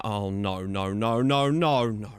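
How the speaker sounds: drawn-out chain of low noes, swelling then dying away; dismayed groaning. Real, not acted. Gamer watches his defence give way, dismayed groan. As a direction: groan helplessly as it goes wrong. Watching a goal being conceded.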